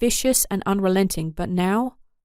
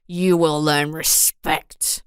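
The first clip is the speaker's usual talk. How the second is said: lower, slow menacing snarl; 4 semitones lower, much slower than usual. Acted. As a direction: growl each word slowly. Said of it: "Hegiel said with a snarl".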